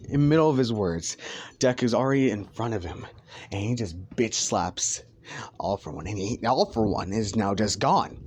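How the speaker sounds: ordinary delivery, nothing marked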